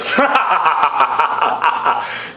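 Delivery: long, high cackling laugh; acted laugh. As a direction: burst into a long staccato put-on laugh. God laughs at [name], acted.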